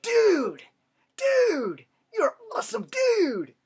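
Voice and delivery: squealed very high and loud, hammed-up; over-the-top mocking fanboy squeal; mock coworker. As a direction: parody him as a squeaky fanboy. Mocking coworker's useless praise.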